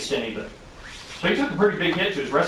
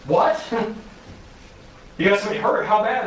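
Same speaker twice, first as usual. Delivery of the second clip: startled fire captain, retold with a raised voice; 6 semitones higher than usual. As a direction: play the captain caught off guard and alarmed. Captain hears of injured man.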